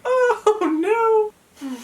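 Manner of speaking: a high squeak of laughter breaking into a whimper; half laugh, half sob, dismayed; laughing cry. Real, not acted. To her viewers about packing alone, a laughing wail. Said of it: "and I'm like".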